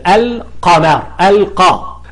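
القمر with clear l and a single q; undoubled letter. Shown as a demonstration. Teacher showing no shadda, القمر with a single q. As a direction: sound the l, keep the q single in القمر. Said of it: "غير مشدد".

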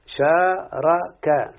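"شارك" stretched out very slowly, every syllable held; very slow demo. Shown as a demonstration. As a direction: draw out "شارك" for the student. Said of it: "teacher drills the verb form". (نطق "شارك" ببطء شديد)

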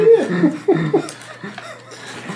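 squealing high laugh; real high cackle of delight; high laughing burst. Real, not acted.

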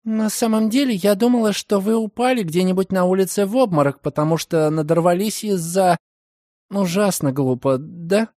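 put-on young woman voice. A narrator's reading. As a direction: confess shyly. Margaret confesses her worry. (смущённый девичий голос)

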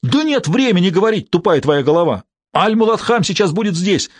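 high-pitched impatient retort; agitated and insistent; exasperated outburst. A narrator's reading. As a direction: argue urgently, pitch jumping up. Acted quarrel, frantic man argues back.

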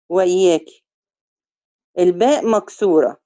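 ordinary talk

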